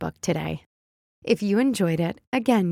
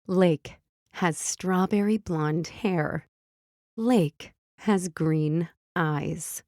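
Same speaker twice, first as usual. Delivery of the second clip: slow, clear storybook reading; reading to children. A narrator's reading. Mom reading phonics book to kids, slow and clear. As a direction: read it gently for little listeners.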